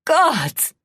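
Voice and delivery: high loud cry torn from a hoarse throat; hoarse princess screaming in despair. A narrator's reading. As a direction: wail it to the heavens. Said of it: "in a voice already hoarse".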